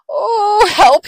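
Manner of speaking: high, loud cry for help; frightened fox. Acted. Fox sees the Gruffalo, high frightened cry. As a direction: wail it in alarm.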